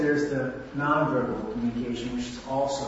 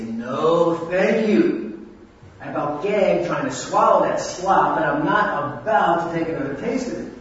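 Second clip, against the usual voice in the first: disgusted refusal; 2 semitones higher, 7 dB louder than usual. Shown as a demonstration. Refusing the wife's pie as slop, loud and disgusted. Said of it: "communicated with the tone of your voice".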